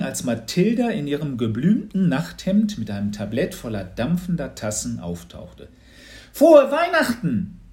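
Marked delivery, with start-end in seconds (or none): 6.3-7.6 high, loud, slow festive greeting; bright-voiced call. A narrator's reading. Girl arriving with steaming cups, bright high call. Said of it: "rief sie mit heller Stimme".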